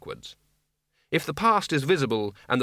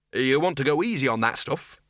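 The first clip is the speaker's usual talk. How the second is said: usual speaking voice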